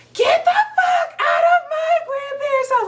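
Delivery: high shout broken by laughter; laughing through a shouted order to leave the house; laughing scream. Retold or imitated. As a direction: scream it while cracking up.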